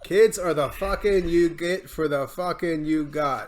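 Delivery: slow and spelled out; slow clarifying. Real, not acted. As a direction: repeat it slowly so they get it.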